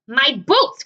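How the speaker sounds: loud, high dismayed cry. Acted. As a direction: wail over the ruined boots. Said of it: "cried the centipede".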